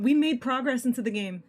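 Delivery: loud, excited announcement; excited triumph. Real, not acted. Player seeing progress in the game, loudly excited. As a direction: call it out loudly, thrilled.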